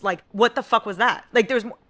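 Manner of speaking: loud exasperated outburst; real frustration. Real, not acted. Ranting to viewers about the ending, loud and exasperated. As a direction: vent it, loud and fed up. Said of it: "I'm angry".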